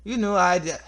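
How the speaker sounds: high, loud, put-on Scottish accent; aggressive Scottish accent. Telling off a rival who got here first, aggressive Scottish accent. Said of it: "what is with this aggressive, like, Scottish accent".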